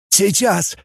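lightly voiced winded excitement; breathless. A narrator's reading. Scientist panting before the screen, mildly breathless. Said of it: "задыхаясь".

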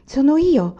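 loud high call across the yard; shout. A narrator's reading. Anania calls out loudly to the unseen girl. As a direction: shout it out so she hears. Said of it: "gridò Anania".

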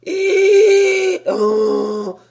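very high, very loud desperate bray; heartrending bray. Acted. Donkey begging the sailors, desperate loud bray. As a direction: bray as if your heart breaks. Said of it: "d'une voix déchirante".

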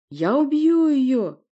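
high, loud, drawn-out squeal; shriek. Acted. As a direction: shriek the threat. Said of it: "взвизгнул Порти".

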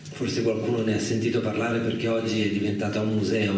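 normal talking voice

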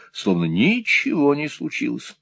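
usual speaking voice

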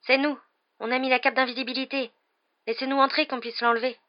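calling out. Acted. Harry calling at the door.

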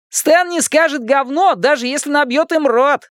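put-on high-pitched cheeky kid; wisecracking boy, teasing. A narrator's reading. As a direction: crow the jibe in a high boyish voice. Narrator plays wisecracking Richie.

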